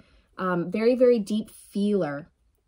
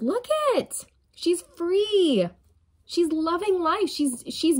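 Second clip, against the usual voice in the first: much higher, delighted voice; excited reveal; 8 semitones higher, slower than usual. Real, not acted. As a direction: gush over the card.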